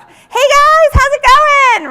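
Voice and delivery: loud, high, over-the-top cheery "hey guys"; mock booth greeter. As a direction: ham up a salesman hailing passers-by. Mimics expo booth greeters.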